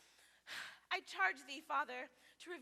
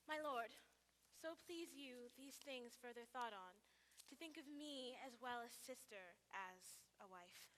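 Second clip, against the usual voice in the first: soft, lowered request; gentle appeal; 4 semitones lower, 12 dB quieter than usual. Acted. Lady asking lord to accept her as sister, soft appeal. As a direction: offer it softly and tentatively.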